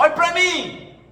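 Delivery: loud, high-pitched acted demand; angry demand. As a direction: mimic an angry person demanding attention. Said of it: "começa a falar comigo com raiva".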